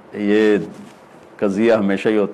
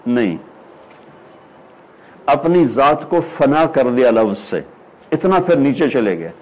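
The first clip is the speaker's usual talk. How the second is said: ordinary delivery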